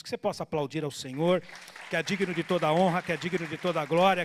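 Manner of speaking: loud rising call to praise God; preacher driving the congregation to applause, full force; full-force preaching. Acted. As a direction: rouse the church to applaud.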